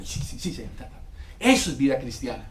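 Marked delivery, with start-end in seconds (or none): 0.0-1.0 unvoiced rapid sí sí; whispered hasty yes. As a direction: agree at once under breath. Quick whispered compliance. (sí susurrado y apurado)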